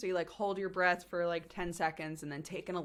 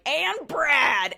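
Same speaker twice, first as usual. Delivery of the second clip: much louder and higher, a cheering reveal; excited announcement; 9 semitones higher, 10 dB louder than usual. Acted. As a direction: announce him like the star arrives. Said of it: "And then you're like".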